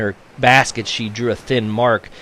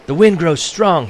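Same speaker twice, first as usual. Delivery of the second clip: raised, higher shout of warning; 7 semitones higher, 4 dB louder than usual. A narrator's reading. Nanko urging the villagers to hurry, shouting. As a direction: call it out over the wind. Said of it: "he shouted".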